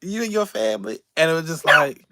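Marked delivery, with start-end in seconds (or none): 0.0-1.0 high, light fan voice; voicing a fan. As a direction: float it up like a sweet older fan. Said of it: "came up to me and was like".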